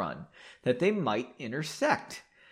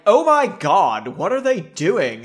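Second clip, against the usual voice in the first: put-on Valley Girl; 4 semitones higher, 8 dB louder than usual. Shown as a demonstration. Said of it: "like a Valley Girl".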